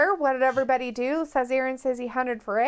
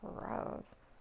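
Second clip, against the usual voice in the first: whispered disgust; 4 semitones lower, 22 dB quieter, much slower than usual. Real, not acted. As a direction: hiss out mock disgust. Reacting to snow news.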